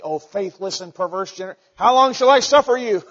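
building to a loud, high exasperated yell; a parent losing patience; fed-up parent. Retold or imitated. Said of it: "feel like saying".

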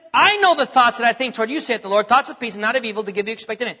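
fast, running the familiar verse together; quick recap of the verse. Shown as a demonstration. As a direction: rattle it off because everyone knows it. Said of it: "Now listen to what he says here".

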